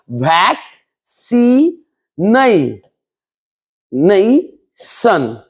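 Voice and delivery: वैक, सी, नई, सन each said alone, long and separated; very slow वैक... सी... नई... सन with pauses between; split into syllables. Shown as a demonstration. Teacher breaking a word into syllables for students, slow and separated. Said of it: "यहाँ पे चार syllable है".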